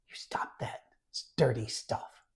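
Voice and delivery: hushed, breathy mock scold; hushed imitation of a scold. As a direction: whisper a scolding he never gave. Imagined scolding Jesus never said.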